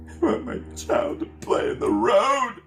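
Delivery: high, rising mock wail; imitating a hammed-up tearful plea; fake crying. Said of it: "the fake crying goes on again".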